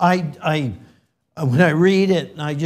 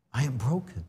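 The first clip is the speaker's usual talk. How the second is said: a near-whisper, awed and low; hushed; 6 semitones lower, 10 dB quieter, faster than usual. Real, not acted. Preacher quietly repeating God's words, hushed. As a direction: let the verse sink in, barely voiced. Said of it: "quiet reprise of verse".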